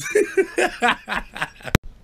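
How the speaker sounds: high, hard laugh breaking the sentence; real laughter. Real, not acted. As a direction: crack up mid-story.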